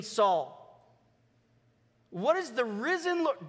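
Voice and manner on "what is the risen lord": ordinary talk, nothing marked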